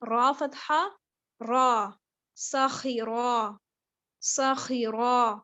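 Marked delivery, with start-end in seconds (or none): 2.4-5.4 ساخرہ slow, with a thin سین and a full heavy خا and را; heavy ra and kha. Shown as a demonstration. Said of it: "را اور خا پر ہیں".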